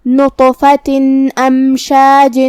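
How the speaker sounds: نطفۃ امشاج chanted slowly, tanween a clear n before the alif. Shown as a demonstration. Teacher demonstrating clear tanween, measured slow chant. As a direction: pronounce a distinct n between نطفۃ and امشاج. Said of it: "ہم یہ حکم کو ایسے ادا کریں گے".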